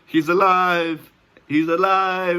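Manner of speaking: high, loud, drawn-out proclamation; mock triumphant shout. Joker announcing the dreamer is alive, mock triumphant shout. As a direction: proclaim it like a mad scientist.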